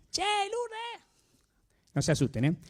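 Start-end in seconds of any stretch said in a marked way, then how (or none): 0.1-1.1 llamado agudo de lejos, apenas marcado; grito imitado. Retold or imitated. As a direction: llamala como desde cincuenta metros. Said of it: "no le puedo gritar a Lourdes". (imitando un grito a Lourdes desde lejos, sin volumen)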